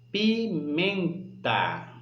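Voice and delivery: pimenta slowly split as pi, MEN, ta; syllable by syllable. Shown as a demonstration. Teacher giving the middle example word, pimenta split slowly with weight on MEN. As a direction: stretch pimenta out, leaning on MEN. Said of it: "o men sai com mais força".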